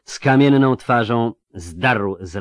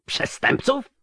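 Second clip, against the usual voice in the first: shouted; slower than usual. Acted. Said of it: "krzyknął Riordain".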